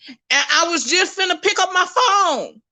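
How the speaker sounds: animated, excited recounting; excited retelling. Retold or imitated. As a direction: lift the pitch as if eager to share news. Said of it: "so excited".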